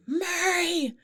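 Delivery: high-pitched mock coach yell. Coach yelling at a student who can't tumble. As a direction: bark it like a gruff gym coach. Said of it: "he yelled".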